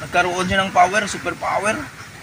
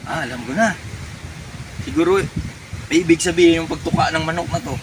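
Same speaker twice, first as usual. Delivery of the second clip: normal talking voice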